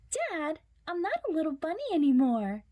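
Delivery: high, fast little rabbit insisting; Jasper's protest. Acted. Jasper insists to Dad he's grown, high and fast. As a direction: squeak it out, fed up with Dad.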